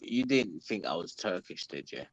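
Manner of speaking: normal talking voice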